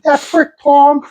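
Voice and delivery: slow, high Pittsburgh drawl; Pittsburgh accent. Shown as a demonstration. Comedian showing the neighbor's accent, slow high Pittsburgh. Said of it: "that's a Pittsburgh accent".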